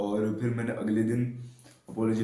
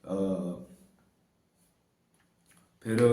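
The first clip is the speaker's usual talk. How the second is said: ordinary talk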